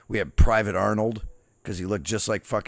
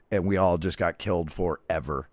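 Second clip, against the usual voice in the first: ordinary talk, nothing marked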